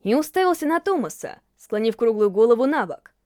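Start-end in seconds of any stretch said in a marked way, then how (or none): none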